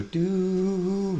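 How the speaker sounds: mock falsetto "Do"; high do. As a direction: float the "Do" up high. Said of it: "really high".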